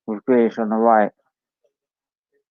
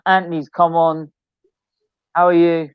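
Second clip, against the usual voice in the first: ordinary delivery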